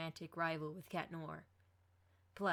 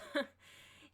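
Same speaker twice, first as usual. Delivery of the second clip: short breathy nervous giggle; nervous laugh; 9 semitones higher than usual. A narrator's reading. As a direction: laugh off the awkward moment. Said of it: "laughing nervously".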